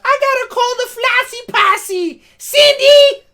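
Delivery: high, loud squealing quote; excited mock shout. Host acting out Ryan rallying the Flossy Posse, excited shout. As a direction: shout it high through a laugh. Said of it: "so she's like".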